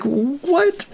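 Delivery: high rising disbelieving "What?"; shocked what. Retold or imitated. Sound guy hearing Joe joke about shooting him, high shocked question. Stunned at Joe's remark.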